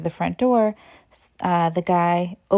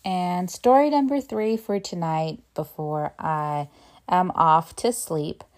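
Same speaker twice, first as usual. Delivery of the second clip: ordinary talk, nothing marked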